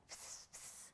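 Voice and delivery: short unvoiced spinning sound; soft little whistly hisses; spinning-dreidel hiss. Herschel spins the dreidel, soft short hisses. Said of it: "Herschel is going like this".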